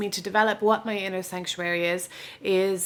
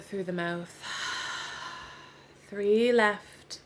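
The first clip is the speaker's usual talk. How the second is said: guided meditation; 3 dB quieter than usual. Real, not acted. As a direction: ease them into rest, unhurried, with long pauses. Leads a yoga nidra relaxation.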